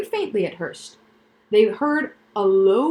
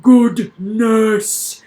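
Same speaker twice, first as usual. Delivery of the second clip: booming laugh-line; 10 dB louder, much slower than usual. Acted. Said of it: "doubling up with laughter".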